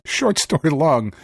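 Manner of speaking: normal talking voice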